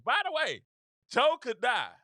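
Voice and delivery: high, loud, punchy teasing tagline; teasing slogan. Shown as a demonstration. Pitching a campaign slogan to Republicans, teasing. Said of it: "I gotta tease it".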